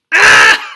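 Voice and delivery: loud burst of laughter; delighted laughing cry. Real, not acted. Reader reacting to the book's line, laughing loudly. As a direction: let out a joyful laugh.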